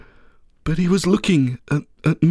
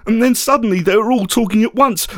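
usual speaking voice

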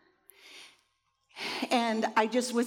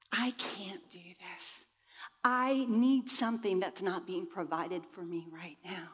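self-pitying whisper; 4 semitones lower, 5 dB quieter than usual. Retold or imitated. Reliving the victim mindset on the trail, defeated whisper. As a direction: whisper it like you've given up.

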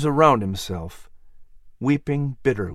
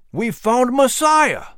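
excited news; 11 semitones higher, 4 dB louder, slower than usual. Acted. Brother announcing great news.

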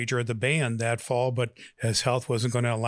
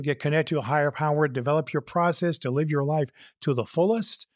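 ordinary talk, nothing marked

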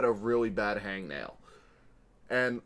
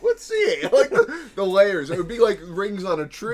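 laughing; 6 semitones higher, 7 dB louder than usual. Real, not acted. Joking about cutting the cyst.

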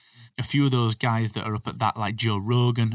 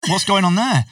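mock surprise; 8 semitones higher, 7 dB louder than usual. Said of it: "And you're like".

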